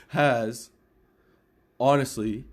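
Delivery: normal talking voice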